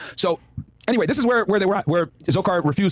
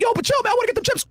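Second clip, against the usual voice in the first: voicing Zokar. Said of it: "Zokar's like".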